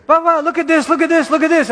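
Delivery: put-on high, eager little-kid voice tugging at dad; eager child. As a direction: play a small kid begging his father to look. Child voiced pestering father.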